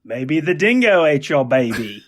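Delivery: slow, hammed-up Aussie quote; mock-dramatic Australian accent. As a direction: stretch it out in a broad Australian accent. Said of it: "Australian sayings with an Australian accent".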